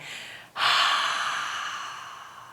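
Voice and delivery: long, airy sigh out; demonstrated sigh. Shown as a demonstration. Yoga teacher showing how to release tension, long sigh. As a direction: let the whole breath go in one long exhale. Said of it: "breathe it out with a sigh".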